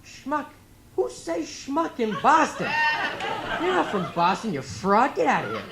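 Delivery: high, loud, mock indignation in a Boston accent; hammed-up Boston-accented scolding; outraged Boston interviewer. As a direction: imitate a Boston man throwing out a fraud. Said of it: "he looked at me and goes".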